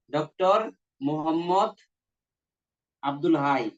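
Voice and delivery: Doctor, Mohammad, Abdul হাই paused one by one; name spelled out slowly. Shown as a demonstration. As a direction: dictate each word. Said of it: "এটা যুক্ত করছে". (নাম থেমে থেমে লেখানো)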